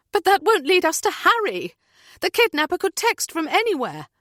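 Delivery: frantic protest; distressed objection. A narrator's reading. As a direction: throw it at the captain in alarm. Heather argues with captain.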